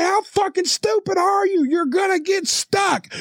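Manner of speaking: high, exasperated imitation yell; mock furious shout, slowing at the end; losing his shit. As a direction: holler it like a worker at wits' end. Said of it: "he really lost his shit then".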